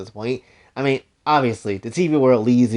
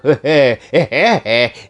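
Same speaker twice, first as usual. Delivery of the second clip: real laugh; 3 semitones higher, 5 dB louder than usual. Real, not acted. Laugh over Caroline.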